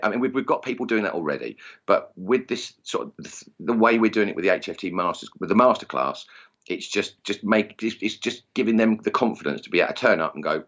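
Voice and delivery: usual speaking voice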